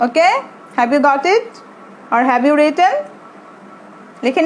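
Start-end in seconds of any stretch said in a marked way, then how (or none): none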